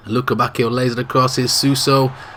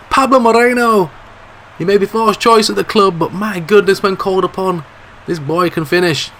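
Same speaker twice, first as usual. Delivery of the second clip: ecstatic goal call; 9 semitones higher, 4 dB louder than usual. Acted. Commentator calling the late winner, ecstatic shout. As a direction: let the winner explode out of you.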